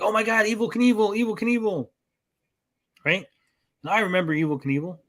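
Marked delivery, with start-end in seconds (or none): 0.0-1.9 put-on high, excited old-timer gushing; hyped old fogies. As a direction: gush like an eager old fan. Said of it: "old fogies are like".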